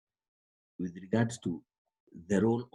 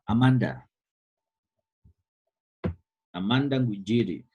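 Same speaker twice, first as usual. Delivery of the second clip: louder call to a student on the line; teacher calling on a student, raised voice; calling out a name; 6 dB louder than usual. Real, not acted. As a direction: call Amanda by name across the class.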